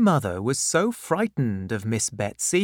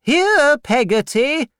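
very high, loud call to the servant; shouting to the kitchen; 8 dB louder than usual. Acted. Aunt Betsy yells to the servant in the kitchen, loud and high. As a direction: call her from the next room. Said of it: "Miss Betsy shouted".

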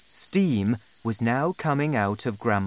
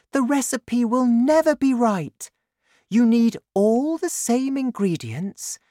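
high, loud, fretful mother voice; high-pitched, insistent objection; Missus Cranky, exasperated; 11 semitones higher, 5 dB louder than usual. A narrator's reading. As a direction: scold them as a fed-up mother.

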